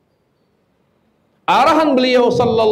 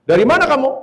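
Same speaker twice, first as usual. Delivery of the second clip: mock angry wife; 6 semitones higher, much faster than usual. Angry wife confronting husband.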